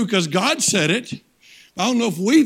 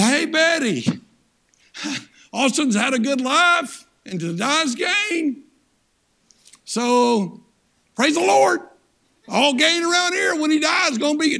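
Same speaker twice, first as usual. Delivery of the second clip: hammed-up good-news phone call about a death; mock cheerful caller; 6 semitones higher than usual. Caller telling his wife Betty he may die, mock cheerful. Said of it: "nobody talks like that".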